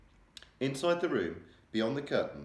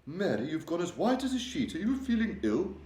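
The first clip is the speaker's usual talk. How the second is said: high, loud, regal voice, shaken; a distressed queen confiding in her maid; 10 semitones higher, 4 dB louder than usual. A narrator's reading. The Queen telling Mary of her nightmare, regal and upset.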